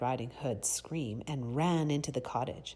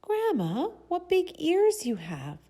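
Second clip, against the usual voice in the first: put-on little girl voice; 6 dB louder than usual. A narrator's reading.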